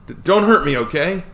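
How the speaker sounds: high, loud stammering plea; scared plea. Acted. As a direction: beg not to be hurt.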